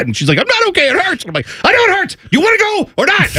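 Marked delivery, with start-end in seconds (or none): 0.4-1.2 very high child imitation; mock wail of a hurt little girl; protesting hurt child. Acted child protesting in pain. 1.6-3.4 very high, strained retort, acted; exasperated parent.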